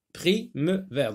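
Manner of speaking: "prime-vers" slowly split as pri, me, vers; syllable by syllable. Shown as a demonstration. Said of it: "On va sectionner".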